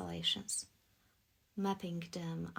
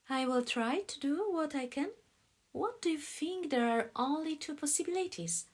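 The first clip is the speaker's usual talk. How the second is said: higher, louder voice for the woman; woman character's voice; 9 semitones higher, 5 dB louder than usual. A narrator's reading. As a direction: play her reply lifted in pitch. Woman's lines in read dialogue.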